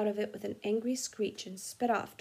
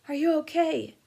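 higher, louder urgent check; urgent question; 7 semitones higher, 7 dB louder than usual. Acted. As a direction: ask fast after the crash. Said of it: "Harry said urgently".

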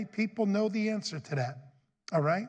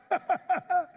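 short, high, loud laugh; burst of laughter; 11 semitones higher, 4 dB louder than usual. Real, not acted. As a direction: laugh out at your own joke. Preacher laughs after outburst.

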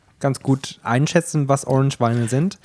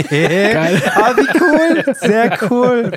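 delighted laughing; 9 semitones higher, 5 dB louder, much slower than usual. Real, not acted. Reacting to the winemaker's message.

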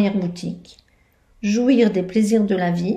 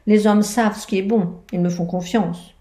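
usual speaking voice

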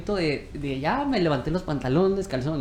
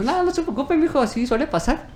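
lightly voiced kind mother; 11 semitones higher than usual. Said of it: "Y me dijo".

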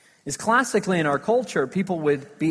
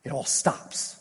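hushed whisper; 4 semitones lower, 6 dB quieter than usual. Real, not acted. Preacher dramatic hush.

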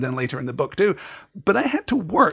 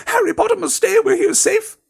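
very high, louder squeak of a frantic elf; squeaky house elf; 4 dB louder than usual. A narrator's reading. Dobby begs Harry Potter to stay home, high frantic squeak. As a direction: squeak it out like a panicked little elf.

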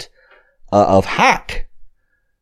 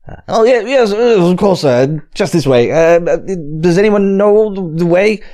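flustered colonel; 9 semitones higher, 4 dB louder than usual. Acted. GM voices flustered colonel again.

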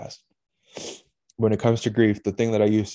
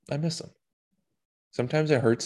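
normal talking voice

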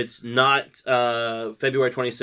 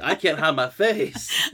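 imitating co-host; 5 semitones higher, slower than usual. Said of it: "she's like".

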